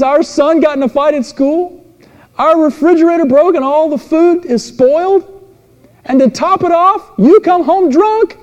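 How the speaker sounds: high, loud mock wife voice; upset wife. Wife unloading her bad day on husband, shrill mock wail. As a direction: squeal it like a frazzled wife.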